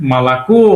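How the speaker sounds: "মালাকো" with the final "ko" stressed, held and rising. Shown as a demonstration. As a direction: lean on the last o of "মালাকো". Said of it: "stress দেওয়া আছে ও এর উপরে".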